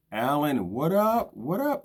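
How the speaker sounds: high, loud hail to a friend; light up as a buddy shows up; excited greeting. Real, not acted. Host greets viewer Alan joining the live, high and loud.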